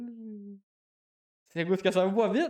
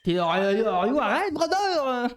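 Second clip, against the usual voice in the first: hammed-up accented English, louder and climbing much higher; Lewis le Fou's English; 6 semitones higher, 6 dB louder, slower than usual. Laughing at how Lewis le Fou speaks English, hammed-up imitation. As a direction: overdo his English, louder and higher each time. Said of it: "lui, quand il parle en anglais".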